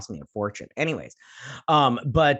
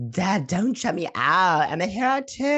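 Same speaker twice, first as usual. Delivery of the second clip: pleading daughter; 7 semitones higher, 3 dB louder than usual. Retold or imitated. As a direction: plead like a put-out daughter, pitch climbing. Voicing daughter pleading with dad.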